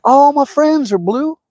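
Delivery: high, drawn-out pretend whine; mock complaint. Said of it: "I'm like".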